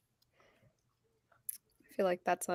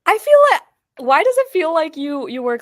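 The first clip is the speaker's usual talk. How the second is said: incredulous; 7 semitones higher, 9 dB louder than usual. Real, not acted. Friend disbelieving work schedule.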